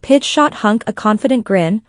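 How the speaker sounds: plain reading voice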